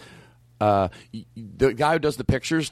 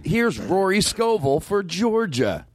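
higher, slow announcer intro; announcer introduction; 8 semitones higher, much slower than usual. Pretend. Host handing over to the comic, slow high announcer intro. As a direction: draw out the introduction, pitched up.

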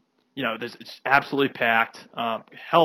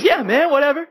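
put-on southern accent; 8 dB louder than usual. Bo brushing off the BC fan's confession, high southern accent. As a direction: imitate an easygoing Southerner, pitched way up. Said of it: "in his southern accent".

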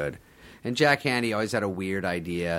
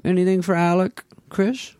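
higher, louder boss calling on someone; raised-voice prompt, lifting at the name; 10 semitones higher, 4 dB louder than usual. Retold or imitated. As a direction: put the question to him, pitched up. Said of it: "And he's like".

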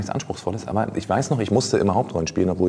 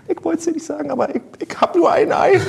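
high squeaky voice; 5 dB louder than usual. Retold or imitated. Acted confession in retold anecdote.

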